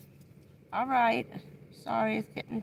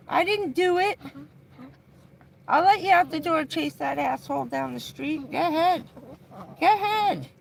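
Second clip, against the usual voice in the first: high, loud shouts at the bird; loud exasperated yelling; 10 semitones higher, 6 dB louder than usual. Real, not acted. Owner yelling at the bird lunging at a passer-by, high loud. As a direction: stop him going after the guy outside.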